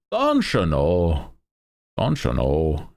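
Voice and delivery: slow, drawn-out Midwest-Irish accent; hammed-up northern accent sliding into Irish; exaggerated near-Irish accent. Shown as a demonstration. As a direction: stretch it out in a mock Irish lilt. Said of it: "starts to sound like it's almost teetering on Irish".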